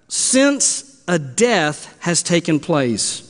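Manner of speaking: "since a death has taken place" read slowly, word by word; slow and deliberate. Shown as a demonstration. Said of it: "Now understand that word death".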